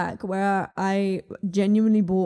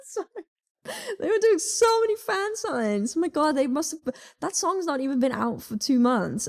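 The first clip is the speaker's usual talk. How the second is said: excited fangirling; 8 semitones higher than usual. Real, not acted. Fangirling laughing about idols.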